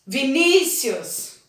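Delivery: "Vinícius" called slowly, stretching the "ní"; slow name call leaning on "ní" in "Vinícius"; calling, stressed syllable. Shown as a demonstration. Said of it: "uma que eu chamo com mais força".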